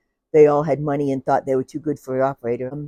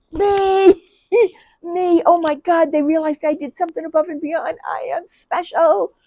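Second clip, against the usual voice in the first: high, loud, giddy with a laugh; bursting with pride, hand up; giddy eager; 11 semitones higher, 4 dB louder than usual. Retold or imitated. Young operator eagerly answering the stern supervisor. Retold eager self volunteering.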